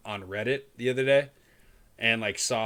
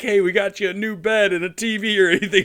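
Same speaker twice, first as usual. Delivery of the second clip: mock cheerful parent; 7 semitones higher, 8 dB louder than usual.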